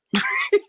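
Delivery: short high whispered laugh; a quick airy laugh of disbelief; breathy laugh. Real, not acted. Laughing at the officer's warrant claim, breathy.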